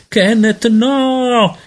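high, loud, panicked yell; shouted cry. A narrator's reading. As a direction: cry out to stop the little one. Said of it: "gridò Peter".

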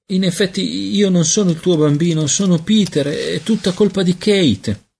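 high-pitched little voice, careful; cauto. A narrator's reading. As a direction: explain cautiously in a high child's voice. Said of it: "disse cauto". (vocina acuta e cauta)

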